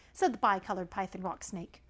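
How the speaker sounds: usual speaking voice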